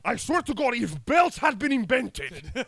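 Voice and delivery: loud, high, drawn-out tirade; hammed-up furious parent; mock-angry threat. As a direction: rant it slowly at the top of your voice. Acted parent threat after Kratos shout.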